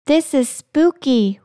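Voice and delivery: usual speaking voice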